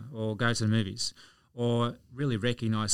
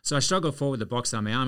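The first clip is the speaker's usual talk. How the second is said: ordinary delivery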